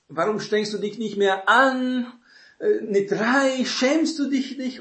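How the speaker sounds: wag a finger at a lazy son, hammed-up; scolding father. Father scolds his son for a three in maths, high and indignant.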